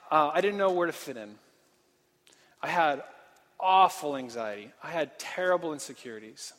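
usual speaking voice

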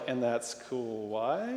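slow, soft, deadpan and low; dryly puncture the excitement; unimpressed wife. Retold or imitated. Wife shrugging off husband's retweet, slow deadpan. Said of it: "she's like".

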